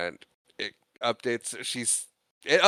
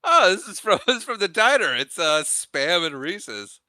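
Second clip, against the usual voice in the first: excited quote; 8 semitones higher, 7 dB louder than usual. Retold or imitated. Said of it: "Marlon's like".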